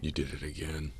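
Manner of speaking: soft, breathy-quiet amazement; barely voiced wonder at her courage; awed hush. Real, not acted. Awed reaction to the story.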